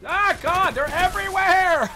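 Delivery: loud, high, stretched-out cry; high-pitched wail of gamer panic; panicked yell. Real, not acted. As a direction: yell as if swarmed by enemies. Swarmed by enemies in game.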